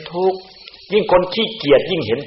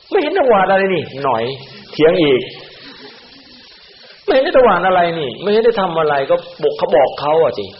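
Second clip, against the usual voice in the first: higher, louder defensive comeback; mock argumentative retort; 6 semitones higher, 3 dB louder than usual. Monk acting a helper who argues back when corrected. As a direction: talk back like a sulky helper. Acted talking back to a scolding.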